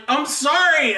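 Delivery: hammed-up pleading whine; mock upset apology. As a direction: imitate a shamed toddler crying out. Acting himself as shamed toddler.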